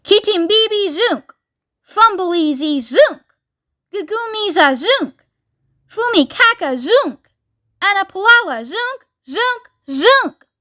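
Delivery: high-pitched, swooping war chant; wild fearsome yell. A narrator's reading. Mister Wonka yelling mystic words at the world, wild yell. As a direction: yell each phrase high and ring out the last word. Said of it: "in a wild and fearsome voice he yelled out".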